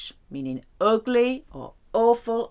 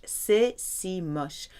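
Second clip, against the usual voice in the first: ordinary talk, nothing marked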